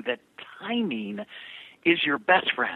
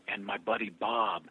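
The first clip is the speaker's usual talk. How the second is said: ordinary talk, nothing marked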